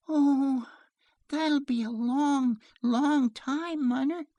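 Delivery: put-on high child's voice, drawn out; dismayed little girl. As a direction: protest to mother like a small child.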